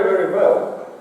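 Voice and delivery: usual speaking voice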